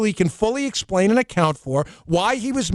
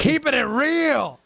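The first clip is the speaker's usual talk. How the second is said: Chris Rock catchphrase; 7 semitones higher, slower than usual. Retold or imitated. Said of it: "Remember that Chris Rock segment?".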